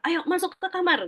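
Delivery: high-pitched, mildly acted scolding; hammed-up snapped command; mock parental snapping. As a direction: order a child off like a fed-up parent. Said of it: "Langsung ngebentak".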